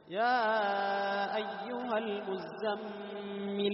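slow chant, final ل of المزمل held moderately. Shown as a demonstration. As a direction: recite with a measured ل ending المزمل. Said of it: "متوسطة". (ترتيل مع لام متوسطة في المزمل)

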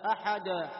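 loud أحد with a vowel tacked onto the d; wrongly stretched dal. Shown as a demonstration. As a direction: say أحد as "ahadaa". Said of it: "خطأ".

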